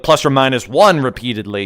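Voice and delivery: ordinary talk, nothing marked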